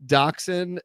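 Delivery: "Dachshund" said as DOCK-sin; corrected pronunciation. Shown as a demonstration. Host restarting the dog's description, "Dachshund" said DOCK-sin. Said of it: "it's pronounced Dachshund".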